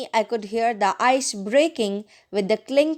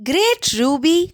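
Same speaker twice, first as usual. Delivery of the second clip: louder, higher cheer; excited cry; 5 semitones higher, 5 dB louder than usual. Acted. Friend cheering a skater's stunt, an excited cry. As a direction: cheer her on loudly. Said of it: "cried Jane".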